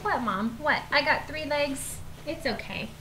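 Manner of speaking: soft, playful voice for a pet; lightly voiced dog. Dog answering Mom about three legs, lightly voiced. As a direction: speak lightly as the unbothered dog. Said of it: "you're like".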